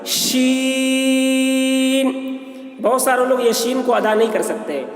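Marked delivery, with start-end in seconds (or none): 0.1-2.1 شین stretched very long and sung; long held letter name. Shown as a demonstration. Teacher demonstrates شین for students, drawn out. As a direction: sing شین out slowly. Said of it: "دیکھیے اس کی آواز ہے".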